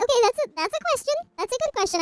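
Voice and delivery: mock reaction. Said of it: "And I'm like".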